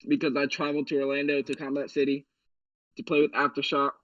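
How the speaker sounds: ordinary talk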